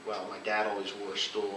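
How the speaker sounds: ordinary delivery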